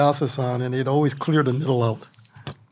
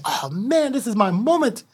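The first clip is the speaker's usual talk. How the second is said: mock lament; 7 semitones higher than usual. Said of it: "I was lamenting actually".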